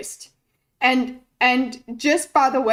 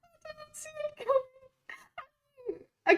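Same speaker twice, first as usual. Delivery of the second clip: giddy squeaky voice, far higher; high pitch; 12 semitones higher than usual. Real, not acted. Streamer gushing over the clip in a giddy high squeak. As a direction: gush it up in a delirious squeak. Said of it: "I've gone into high pitch".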